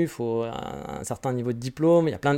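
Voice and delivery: ordinary talk, nothing marked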